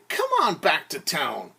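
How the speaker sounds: usual speaking voice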